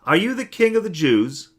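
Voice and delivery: a stern ruler demanding an answer; Pilate questioning. A narrator's reading. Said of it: "And Pilate asked him".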